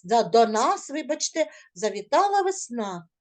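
usual speaking voice

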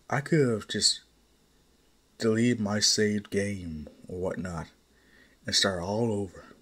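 usual speaking voice

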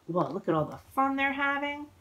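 plain reading voice

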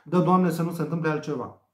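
ordinary delivery

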